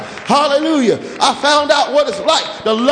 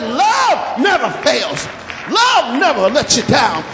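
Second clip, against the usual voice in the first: higher, shouted sermon climax; preaching at full force; 6 semitones higher than usual. Acted. Pastor driving home love to the church, in full-force preaching. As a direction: lift it and hammer every line.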